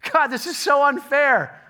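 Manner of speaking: high, loud whine; whiny complaint. Acted. Complainer loudly whining to God about fairness. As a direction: whine like a sulking child.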